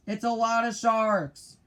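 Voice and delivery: high, loud panicked yell; pirate captain yelling in fear; frightened yell. A narrator's reading. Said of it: "yelled Ben Ali".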